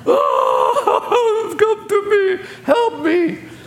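high wailing ghost cry, hammed up; mock llorona wail. Preacher mocking a weeping ghost woman, high wail. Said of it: "they had a llorona crying for him".

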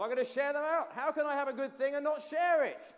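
high, loud joyful cry; exclaiming. Pretend. Deciding to share the sweets, high loud exclaim. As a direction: throw it out with delight. Bursting out with generosity.

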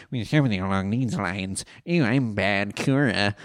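hammed-up cartoon villain introducing himself; Bad Kura. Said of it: "I think my voice for him from last time was".

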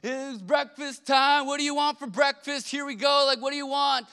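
high, loud call down the stairs; a dad hollering to the kids downstairs. Retold or imitated. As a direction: yell down to the kids in the basement. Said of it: "I kinda yelled down to them".

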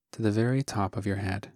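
soft, unhurried meditation voice with long pauses; calm, hushed instruction, phrase by phrase; guided meditation. Acted. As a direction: guide listeners gently through a body scan, leaving space after each phrase. Leads a guided body scan.

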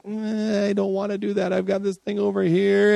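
mildly squirm out of it; reluctant mumble. Retold or imitated. Acted reluctant excuse.

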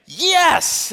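a sudden high, loud, thrilled shout of yes; excited yes. Acted. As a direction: shout it out like you can't believe your luck. Peter invited to walk on water.

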